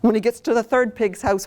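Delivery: ordinary delivery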